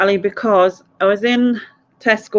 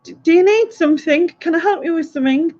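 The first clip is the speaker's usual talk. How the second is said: put-on little old lady; 9 semitones higher than usual. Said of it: "little old lady came up to us and she went".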